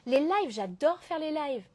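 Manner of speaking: ordinary talk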